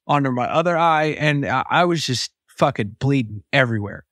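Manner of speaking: ordinary delivery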